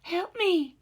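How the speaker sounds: high, loud cry for help; frightened woman on the riverbank crying out for help; desperate woman calling. A narrator's reading. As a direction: plead from far off across the water. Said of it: "It was a colored woman's voice".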